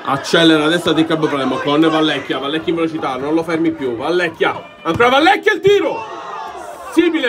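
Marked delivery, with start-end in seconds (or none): 4.8-7.3 excited shout. Real, not acted. Shot hits the post.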